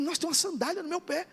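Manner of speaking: retold amazement at a sudden discovery; amazed. Preacher recalls feeling a sandal on his foot, amazed.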